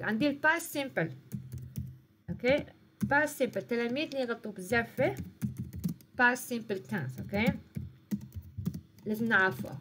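normal talking voice

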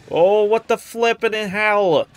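high, loud, drawn-out disbelief; slow, loud burst of startled disbelief; startled exclamation. Real, not acted. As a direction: stretch it out loudly in alarm. Startled by game sound.

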